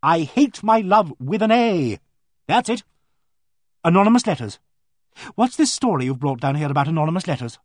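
high, loud burst of sudden recollection; emphatic vicar's wife. Acted. Vicar's wife remembering what she wanted to ask, excited and emphatic. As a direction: seize on the lost thought and declare it.

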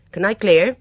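a stiff housekeeper at the door; lightly voiced grim charwoman. Mrs Finch asks to clear.

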